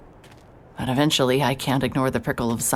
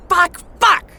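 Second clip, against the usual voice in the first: rising, ever louder screams of frustration; screamed curses; 6 dB louder, slower than usual. Acted. Alone on the cliff, screaming out all her frustration.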